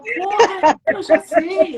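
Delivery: laughing, exasperated outburst at a pest; exasperada. Retold or imitated. Retruca irritada ao espírito insistente. (exasperação encenada, em voz alta)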